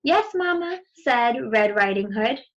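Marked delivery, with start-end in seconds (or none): 0.0-0.8 put-on higher, sweet little girl; lightly voiced young girl. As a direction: answer Mamma like a dutiful child. Child answers mother in story.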